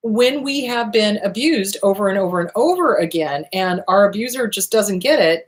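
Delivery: ordinary talk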